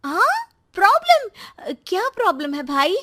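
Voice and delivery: high, startled questioning; surprised sister. Acted. Sister questioning brother about the girl's problem, surprised. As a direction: jump up in pitch, taken aback.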